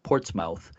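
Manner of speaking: "Portsmouth" said as ports-MOUTH, like mouth; spelling pronunciation. Shown as a demonstration. Host explaining the spelling to listeners, "Portsmouth" said as spelled. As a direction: say "Portsmouth" with a full "mouth" ending. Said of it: "it's spelled like Portsmouth".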